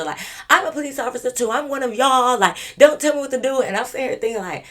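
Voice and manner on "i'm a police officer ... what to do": much higher, louder, a bit fast; mock earnest insistence. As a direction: retell her insisting to the cop. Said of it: "she was telling a police officer".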